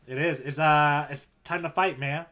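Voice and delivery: ordinary delivery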